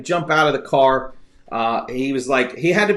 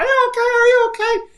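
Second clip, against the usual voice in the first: put-on high-pitched old man scream; 3 dB louder than usual. Telling the stream how the old driver shrieked, high and panicked. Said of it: "He's just screaming".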